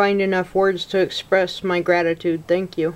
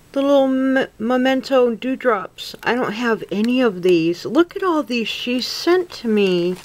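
ordinary talk, nothing marked

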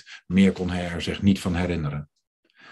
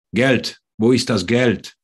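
louder, higher-pitched German demand; raised voice, insistent questioning; barked demand; 4 semitones higher, 5 dB louder than usual. A narrator's reading. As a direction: demand it like a robber. Robbers' German demand, quoted by victim.

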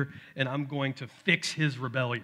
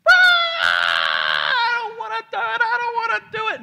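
very high, loud, slow crying shout; toddler tantrum wail; 11 dB louder than usual. Retold or imitated. Dad imitating his son's tantrum, high loud crying shout. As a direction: wail it like a meltdown child. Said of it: "Mount Saint Helens".

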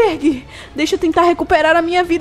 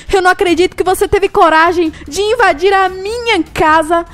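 normal talking voice

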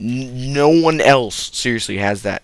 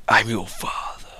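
1 whispered father reveal. Pretend. Vader-like father line.

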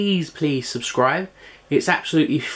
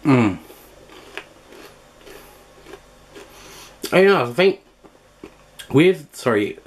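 talking round a mouthful; words through a bite of food; mouth full. Real, not acted. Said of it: "speaking with my mouthful".

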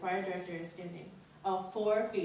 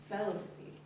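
ordinary delivery

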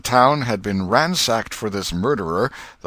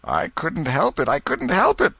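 rising, high anguished moan; 10 semitones higher, 4 dB louder than usual. Acted. Potter answers the crowd, moaning helplessly. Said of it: "Potter moaned".

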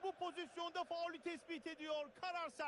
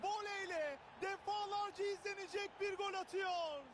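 screaming football commentator; 4 semitones higher, slower than usual. Acted. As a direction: roar the goal for the crowd. Goal scored in match commentary.

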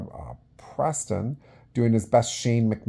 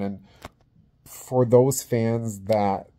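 normal talking voice

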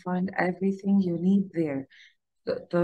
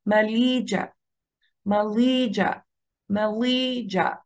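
ma-LAY-sia, long middle syllable in ماليجا; ماليجا with light first and last syllables; middle-syllable stress; slower than usual. Shown as a demonstration. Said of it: "الاسترس على المقطع اللي في النص".

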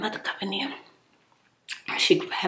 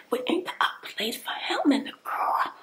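usual speaking voice

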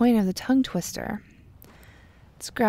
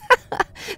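laugh; 13 semitones higher, 5 dB louder than usual. Real, not acted. Fails the tongue twister.

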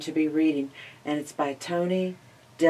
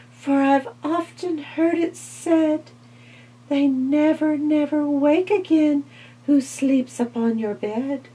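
housefly; 11 semitones higher, 6 dB louder than usual. Acted. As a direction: plead it in a tiny high voice. Fly refuses.

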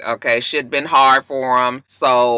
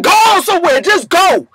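much higher, louder shooing snap; mock angry; 7 dB louder than usual. Imitating [name] shooing [name] away, loud. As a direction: wave her off in a high mock huff. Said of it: "He was like".

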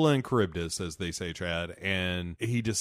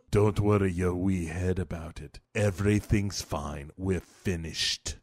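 low, villainous put-on voice; hammed-up sinister calm; menacing; 5 semitones lower than usual. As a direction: reassure him as a veiled threat, slowing at the end. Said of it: "saying it all menacing like that".

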